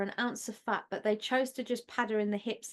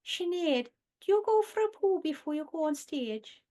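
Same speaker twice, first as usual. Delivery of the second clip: higher, sing-song Welsh-sounding lilt; Vic and Bob quote; 8 semitones higher than usual. Retold or imitated. Quoting a Vic and Bob sketch interviewing Sinead, Welsh-ish lilt. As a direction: ask it in a lilting Welsh-ish voice. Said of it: "it sounds a bit Welsh".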